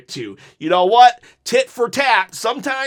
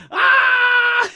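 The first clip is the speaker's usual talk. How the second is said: mock yelp; 5 dB louder than usual. Imitating the handshake dodge.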